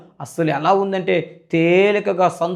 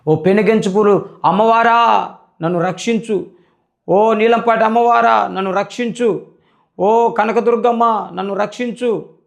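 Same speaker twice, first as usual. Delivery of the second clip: high, loud cry to the goddesses for rescue; desperate loud plea; 6 semitones higher, 3 dB louder than usual. Retold or imitated. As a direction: call out for help at full voice, pleading. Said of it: "అని చెప్పి ఎంతో, ఏ, అడుగుతూ ఉన్నానండి". (ఎత్తైన గొంతుతో గట్టిగా వేడుకోవడం)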